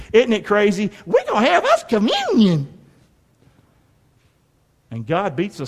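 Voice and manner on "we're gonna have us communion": high sarcastic sing-song; mock the careless churchgoer. Preacher mocking careless communion-takers, high sarcastic voice.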